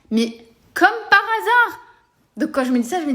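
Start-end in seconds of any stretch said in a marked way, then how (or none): 0.1-1.8 high, slow mock disbelief; sarcastic. Real, not acted. Mocking her ex's convenient timing, sarcastically. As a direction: drag it out, dripping with sarcasm.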